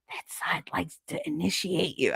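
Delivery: unvoiced, secretive whisper; conspiratorial whisper. Grandma warning her grandchild about Christine, whispered.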